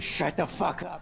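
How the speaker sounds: slow, drawn-out mock yell; hammed-up shout out of a window; groggy neighbour's mock complaint, dragged out slowly; grumpy yell. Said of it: "looking out the window".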